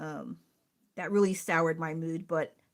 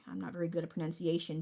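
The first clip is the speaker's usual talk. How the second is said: ordinary talk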